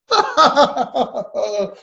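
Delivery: high, hammed-up laughing fit; mock drunken guffaw; loud drunken laugh. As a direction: imitate a drunk man cackling away. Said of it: "he's laughing".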